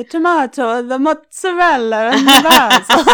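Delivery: glitchy Italian robot voice, lightly done; mock Italian robot. Cohost imitating a contestant with a building, hammed-up Italian accent. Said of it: "like an Italian robot that was glitching".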